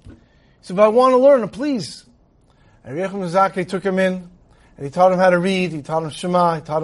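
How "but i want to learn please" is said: retold pleading. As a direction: imitate an insistent student begging to learn. Student pleads with the rabbi.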